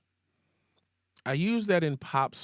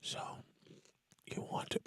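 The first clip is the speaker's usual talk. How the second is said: breathy ASMR whisper breaking into laughter; 11 dB quieter, faster than usual. Pretend. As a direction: whisper close to the mic, then laugh. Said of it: "We'll change this into an S M R video".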